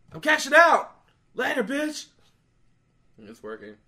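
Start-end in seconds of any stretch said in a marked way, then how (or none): 0.1-2.1 high, loud, cocky sign-off; retold triumphant exit. Winner leaving the angry gambler behind, retold triumphant exit. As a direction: crow it as you leave. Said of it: "He said exactly like that".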